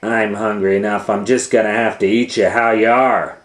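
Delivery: loud, fed-up declaration; loud exasperated witch; impatient witch. Acted. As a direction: announce it with lost patience.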